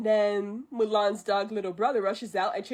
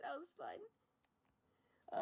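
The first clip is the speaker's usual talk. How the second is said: very soft, slow, worn out; quiet sigh; 8 semitones higher, 17 dB quieter, much slower than usual. Real, not acted. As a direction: breathe it out exhausted.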